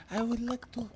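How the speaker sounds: high, soft, badly faked Congolese accent; mock Congo accent. Host trying an African accent on request, badly faked Congolese. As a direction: put on a clumsy Congo accent. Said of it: "I did like a Congo".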